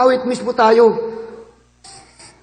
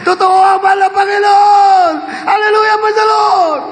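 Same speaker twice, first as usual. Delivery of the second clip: raised, ringing preacher's shout; preaching; 5 semitones higher than usual. Real, not acted. Preacher proclaiming God to the congregation, shouting. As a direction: proclaim it to the congregation at full force.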